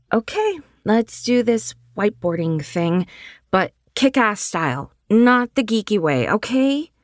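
eager, energetic friend planning fun; upbeat young woman. Acted. Riley's eager dialogue.

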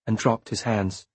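usual speaking voice

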